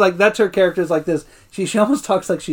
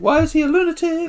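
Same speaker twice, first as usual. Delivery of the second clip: much higher baffled cry; mock exasperated Nellie; 11 semitones higher than usual. Friend joining the Nellie bit, high baffled cry. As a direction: wail it in disbelief.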